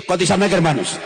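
más fuerte, pidiendo respuesta; llamado fuerte. Real, not acted. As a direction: clama a la congregación. Predicador pide el amén. (el predicador pide el amén a los hermanos, en voz fuerte)

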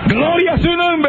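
high, slow exultant cry; glory shout to the congregation; ecstatic praise. Real, not acted. Preacher shouts praise mid-testimony. (grito exultante, agudo y lento)

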